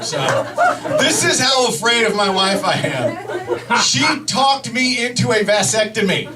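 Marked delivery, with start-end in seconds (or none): none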